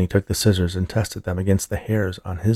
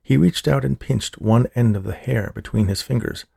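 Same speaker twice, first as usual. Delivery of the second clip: ordinary delivery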